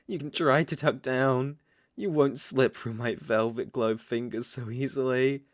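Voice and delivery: ordinary talk, nothing marked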